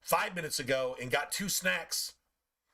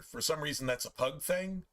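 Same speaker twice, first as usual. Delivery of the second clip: ordinary delivery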